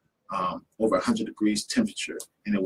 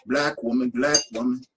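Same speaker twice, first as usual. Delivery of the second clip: rhythmic spoken-word chant; poem recital; 3 semitones higher than usual. Acted. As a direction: perform it like spoken-word poetry.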